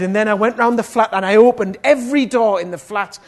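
emphatic shouted retelling; excited retelling. Retold or imitated. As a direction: build her urgency at full volume. Retells her opening every door.

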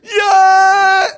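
big loud high cheer; crowd cheer. Retold or imitated. Stadium crowd cheering the runner home, shouting.

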